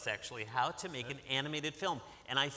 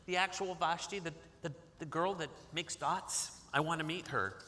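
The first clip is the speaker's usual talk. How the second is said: put-on excited little boy; 3 semitones higher than usual. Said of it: "He's like".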